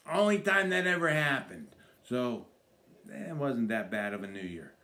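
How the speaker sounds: normal talking voice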